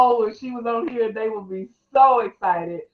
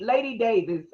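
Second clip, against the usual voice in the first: usual speaking voice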